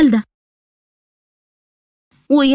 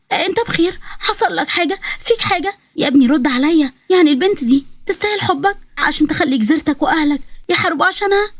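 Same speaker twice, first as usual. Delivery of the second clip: high, anxious mother's questions; high-pitched worried scolding; alarmed mother; 4 semitones higher than usual. Acted. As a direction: fuss over your son in a raised pitch. Narrator voices alarmed mother.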